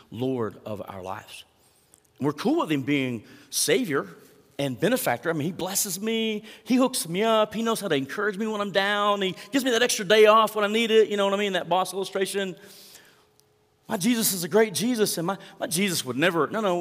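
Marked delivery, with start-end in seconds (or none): 5.3-11.5 mock easygoing believer. Mocking a consumer attitude.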